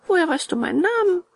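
high, quick question; puzzled puppet boy. A narrator's reading. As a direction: ask high and fast, surprised. Bengele questions the fox. (hohe, schnelle Puppenstimme)

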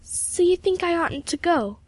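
high, slow, worried question; anxious. A narrator's reading. Pupil seeking her mentor's approval, anxious. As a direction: ask anxiously, pitch lifted high. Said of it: "said Emily anxiously".

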